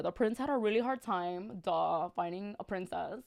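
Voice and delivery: ordinary talk, nothing marked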